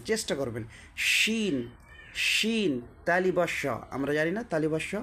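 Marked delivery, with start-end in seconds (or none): 1.0-2.7 শিন drawn out on a higher pitch; careful sheen demonstration. Shown as a demonstration. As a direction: model শিন with a full sh and long ee. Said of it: "ভালো করে উচ্চারণটা দেখেন কীভাবে বের করছেন".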